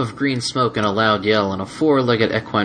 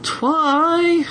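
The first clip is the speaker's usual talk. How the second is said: high drawn-out moan; embarrassed dragon moaning her name; moaning plea. Acted. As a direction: beg her not to say it. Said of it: "moaned the dragon".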